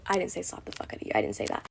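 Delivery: whispered, fast, hushed correction; whispered aside. Real, not acted. Taking back what she said about slapping, quick whisper.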